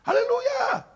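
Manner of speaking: very high, loud hallelujah; demonstrated piercing cry of praise; loud shout. Shown as a demonstration. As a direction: shout it out for a whole mall to hear. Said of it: "coba teriak haleluya keras di mall".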